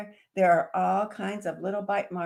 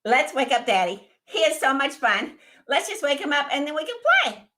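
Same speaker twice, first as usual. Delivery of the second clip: put-on excited baby dragon; 7 semitones higher, 7 dB louder, faster than usual. Dragon proposes plan.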